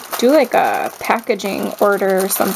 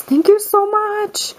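much higher, sing-song thank you; gushing thanks; 11 semitones higher than usual. Real, not acted. Thanking a crafter friend for stationery, high and gushing. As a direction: squeal your thanks up high.

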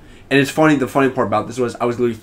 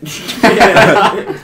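loud laugh; 9 semitones higher, 7 dB louder than usual. Real, not acted. Friend laughing at his own crash story, loud real laughter. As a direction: burst of high real laughter.

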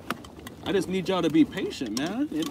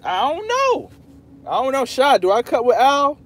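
pitched-up, louder mock frustration; mock exasperated; 9 semitones higher, 7 dB louder than usual. To chat asking if he cut ties with Al, mock exasperation. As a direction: play at being fed up with the question. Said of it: "I'm playing, I'm not upset".

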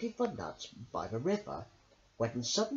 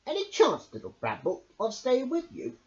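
a lightly voiced hedgehog, hopeful; 9 semitones higher, 4 dB louder than usual. A narrator's reading. Hedgehog asks to stay with Bramble, hopeful. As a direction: ask sweetly for a bed.

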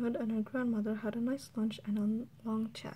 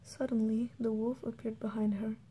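plain reading voice

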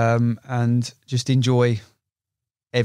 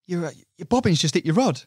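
lightly voiced mate, higher, urgent; retelling the mate spotting the bite, a light higher voice; mate's warning; 6 semitones higher than usual. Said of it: "And he's gone".

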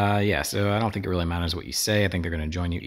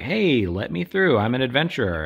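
hammed-up hopeful hero voice; eager adventurer; 6 semitones higher, 5 dB louder than usual. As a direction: announce yourself like a plucky hero. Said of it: "So Meg is like".

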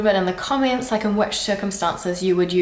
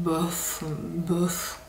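softer, pouty bouf with a shrug; pouting bouf; 2 semitones lower, 8 dB quieter than usual. Shown as a demonstration. Demonstrating a top-level French shrug. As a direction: mutter bouf with a pout. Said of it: "with the shrug and the pout".

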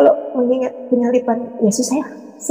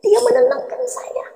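moved, tearful; 5 semitones higher, 3 dB quieter than usual. Retold or imitated. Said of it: "Saya bilang".

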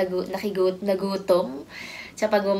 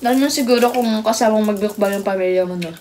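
speaking between bites; talking while eating; 5 dB louder, faster than usual. Real, not acted. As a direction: mouth partly full. Seen 0: left woman eats with her hands, puts food in mouth mid-speech.

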